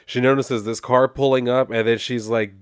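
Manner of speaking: normal talking voice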